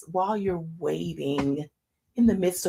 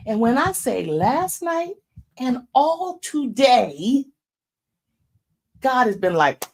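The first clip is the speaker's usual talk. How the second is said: preaching emphasis; 6 semitones higher, 7 dB louder than usual. Real, not acted.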